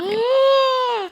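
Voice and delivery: big high gasp; sharp, high intake of breath; excited gasp. Real, not acted. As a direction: gasp in delighted surprise at the news. Reacting to Maui news.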